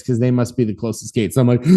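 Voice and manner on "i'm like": sharp breathless gasp. Retold or imitated. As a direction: gasp for air. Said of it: "I can't breathe 'cause I'm dying".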